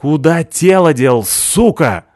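loud, slow furious yell. A narrator's reading. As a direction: bellow it in his face. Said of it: "заорал я на него".

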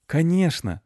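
high, joyful yes; delighted. A narrator's reading. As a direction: burst out happily. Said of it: "обрадовался мальчик".

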